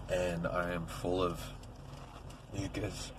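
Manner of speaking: rough, raw morning voice; hoarse from a sore throat; gravelly voice. Real, not acted. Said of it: "my voice is, like, real gravelly".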